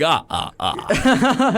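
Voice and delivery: slow, high mock count laugh; vampire laugh. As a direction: cackle like a cartoon vampire. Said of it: "doing the vampire voice".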